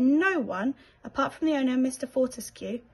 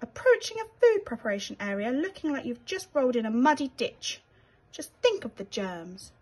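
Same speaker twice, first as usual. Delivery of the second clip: a haughty, horrified rat, higher voice; prim night-manager rat appalled by dirt; fussy disgusted rat; 4 semitones higher than usual. Acted. Rat voices disgust at grubby mouse.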